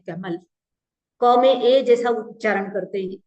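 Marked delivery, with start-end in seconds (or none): 0.0-0.4 a soft Rajasthani कैमल with an open ae in the first syllable; Rajasthani pronunciation. Retold or imitated. Teacher quietly imitating how Rajasthani speakers say kamal. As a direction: quietly say कैमल with an ae vowel, kae-mal not ka-mal. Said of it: "कमल को कैमल बोलते हैं".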